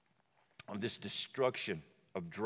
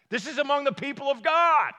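much higher and louder exclamation; incredulous shout; 14 semitones higher, 12 dB louder than usual. Real, not acted. Pastor to congregation, loud incredulous exclamation. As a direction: shout it in disbelief.

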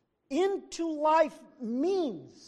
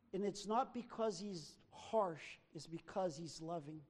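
tender soft; 8 semitones lower, 13 dB quieter than usual. Acted. Tender softening of message.

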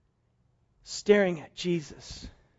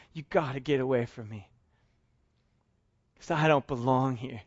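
broken, humble admission; ashamed confession; 4 semitones lower than usual. Acted. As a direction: confess unworthiness. Peter's repentant confession.

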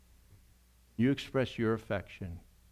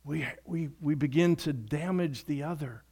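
raised, emphatic preaching; 7 semitones higher, 6 dB louder than usual. Acted. Pastor urging the congregation to love, preaching hard. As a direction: drive the point home from the pulpit.

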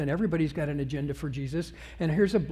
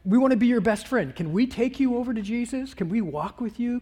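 high, eager hangers-on; suddenly friendly crowd; 5 semitones higher, 4 dB louder than usual. Acted. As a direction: play a crowd sucking up to him. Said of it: "All of a sudden the crowd's going".

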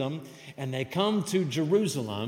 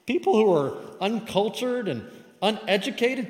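scornful dig; 5 semitones higher, 5 dB louder than usual. Acted. Crowd belittling simple country folk, scornful dig. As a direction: look down on them with mock disbelief. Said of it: "This is a dig at them".

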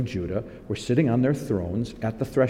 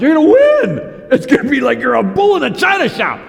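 shouted, much higher cheerleader hype; mock the crowd yelling victory; 11 semitones higher, 7 dB louder than usual. Preacher mimicking the false prophets, high hammed-up shout.

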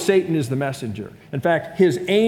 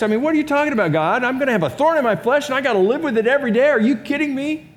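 higher, fast, incredulous mock protest; exasperated complaint; 6 semitones higher, faster than usual. Believer protesting to God, fast and incredulous. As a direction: rattle off the complaint in disbelief.

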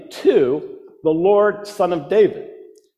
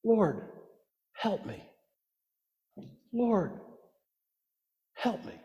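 hushed, much softer plea at her feet; reverent whisper; 12 dB quieter than usual. Retold or imitated. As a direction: whisper it like a kneeling prayer. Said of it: "hear her reverent whisper".

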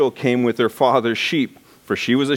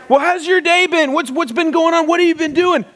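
much higher, louder gushing phone voice; overeager youth pastor; 3 dB louder than usual. Retold or imitated. Young pastor phoning a junior high boy, gushing. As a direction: pour on the enthusiasm.

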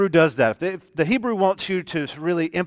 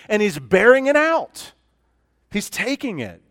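rising, louder preaching, higher pitch; fervent preaching; 5 semitones higher, 7 dB louder than usual. Acted. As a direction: press the point home with building fervor. Preaching builds on Second Corinthians.

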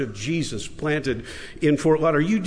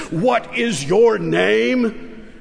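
higher, slow, angry demand; rising anger; 7 semitones higher, 3 dB louder, much slower than usual. A narrator's reading. Red-faced king demands the boy's name again, angry and slow. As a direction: press the question with mounting fury.

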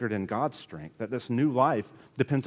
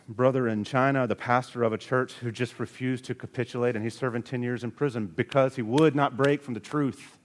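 usual speaking voice

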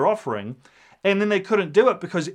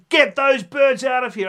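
higher, louder mock yell at the dove sellers; retold yell; 8 semitones higher, 5 dB louder than usual. Jesus yelling at the dove sellers, a high loud mock yell. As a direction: bark it like a furious temple raid. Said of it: "it says that he yells at them".

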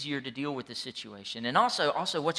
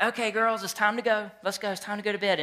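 put-on higher, upbeat calling the girls out; father calling girls from the pool, higher and bright; cheery parent call; 6 semitones higher than usual. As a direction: sing out it's time to go. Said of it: "I was like".

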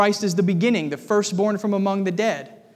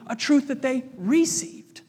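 higher, with "received" opening on a stressed REE; contrastive stress on "received"; 5 semitones higher than usual. Real, not acted. Preacher setting received truth against conceived, stress on first syllable of "received". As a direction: set "received" apart, leaning on its first syllable.

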